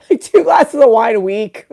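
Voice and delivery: loud, laughing through the line; laughing mock answer. Patient's fib to the doctor, mocked with loud laughter. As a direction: crack up while repeating the fib. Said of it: "And you're like".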